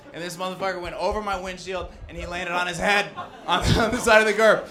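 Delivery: usual speaking voice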